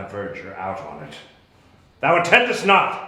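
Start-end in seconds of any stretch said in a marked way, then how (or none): 2.0-3.1 higher and louder reproach; loud exasperated rebuke; scolding outburst. Acted. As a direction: catch her drifting and scold. Prospero scolds Miranda again.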